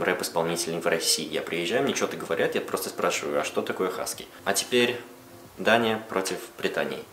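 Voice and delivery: ordinary talk, nothing marked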